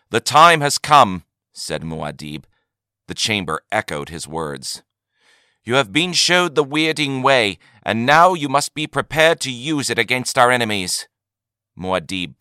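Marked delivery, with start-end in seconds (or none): 0.1-1.2 loud, high, rousing leader; commanding crowd address. A narrator's reading. 5.6-11.1 loud, high, rousing leader; commanding crowd address. A narrator's reading.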